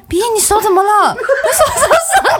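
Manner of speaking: high, sing-song clueless asking; a hammed-up naive girlfriend, half laughing; mock innocent question. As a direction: play dumb as if hearing it first. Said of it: "我后来也是说".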